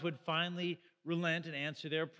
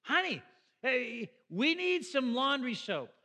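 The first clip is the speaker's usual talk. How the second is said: calling loud and high up the stairs to his wife; husband hollering chores up to his wife, loud and high; mock yelling upstairs to wife; 5 semitones higher, 4 dB louder than usual. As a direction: shout the errand list up to the bedroom. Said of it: "I just yelled up to her like".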